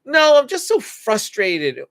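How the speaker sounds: put-on frustrated child. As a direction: mimic a kid correcting the parent. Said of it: "Some kids are gonna look when we say".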